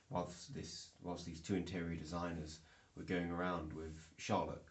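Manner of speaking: normal talking voice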